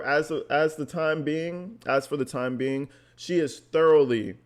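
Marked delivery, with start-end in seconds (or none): none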